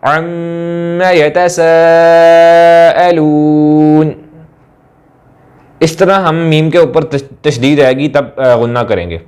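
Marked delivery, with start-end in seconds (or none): 0.0-4.1 very slow chanted tilawat, the doubled meem of अम्मा held long through the nose; slow melodic recitation with a long nasal meem; Quranic recitation with ghunna. Shown as a demonstration. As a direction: hum the meem in अम्मा like a qari. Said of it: "मीम के ऊपर तशदीद आ जाए तो कैसे पढ़ेंगे".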